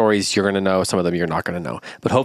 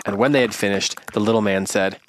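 ordinary delivery, nothing marked

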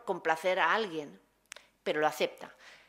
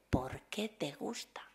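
slow, breathy coaching whisper; 3 semitones lower, 6 dB quieter, slower than usual. Retold or imitated. Prisoner teacher coaching a scared boy, whispered slowly.

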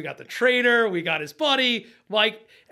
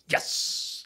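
whispered yes; 7 dB quieter than usual. Retold or imitated. First squid caught.

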